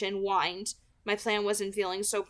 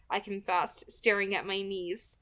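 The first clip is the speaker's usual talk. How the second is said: ordinary delivery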